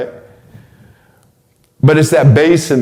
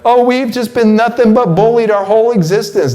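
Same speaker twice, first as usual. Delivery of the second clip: much higher mocking whine; mock complaining woman; 10 semitones higher than usual. Mocking women claiming lifelong bullying, high mock whine. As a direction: parody the overblown complaint.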